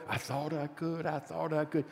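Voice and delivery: soft, hushed little train easing downhill; relieved little engine. Retold or imitated. Preacher recalling the engine at the hilltop, soft relieved murmur. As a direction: sigh it out gently like the engine coasting. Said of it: "at the top of the hill going down".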